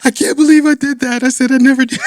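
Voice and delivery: high, hammed-up parental regret; mock lament ending in laughter; remorseful parent. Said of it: "I'm a terrible parent".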